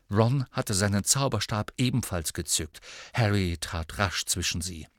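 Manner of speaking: usual speaking voice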